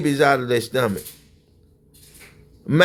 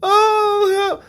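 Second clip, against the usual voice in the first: imitated holler for help.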